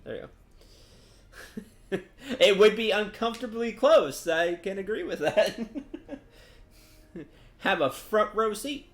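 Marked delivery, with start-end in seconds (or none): 1.7-8.9 talking through real laughter, pitched up; amused laughing. Real, not acted. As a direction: chuckle through the words, higher and louder. Amused by the line just read.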